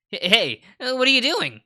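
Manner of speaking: startled yelp, high and loud. Acted. Boy yanked away by girl, startled high yelp. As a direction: caught off guard as she drags him off. Pulled away by the arm suddenly.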